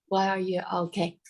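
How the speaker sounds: usual speaking voice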